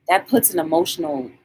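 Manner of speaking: ordinary talk, nothing marked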